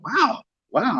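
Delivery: high amazed wow; stunned wow. Retold or imitated. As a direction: gasp it out in shock. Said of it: "I was just in shock".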